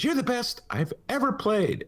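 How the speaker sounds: high-pitched put-on voice of a girl chess genius; imitation of Beth. Orphan chess genius tells her Russian opponent, high-pitched imitation. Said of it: "She says".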